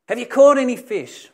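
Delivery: high, carrying shout; yelled out. Acted. Stranger on shore calling to fishermen, yelling. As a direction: call out loudly over distance. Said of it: "this person on the beach yells out".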